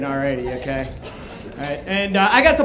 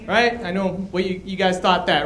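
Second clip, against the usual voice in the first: ordinary talk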